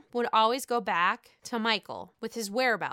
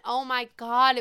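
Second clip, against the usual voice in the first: ordinary delivery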